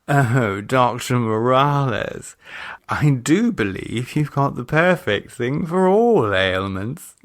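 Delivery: flirt with the doctor; flirty Cynthia. Acted. Cynthia flirting with Doctor Morales, coy.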